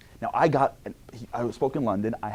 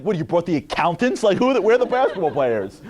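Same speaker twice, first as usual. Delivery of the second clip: higher, louder mocking tone; mocking opponents; 8 semitones higher, 8 dB louder than usual. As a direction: jeer at the short team. Public school kids mocking.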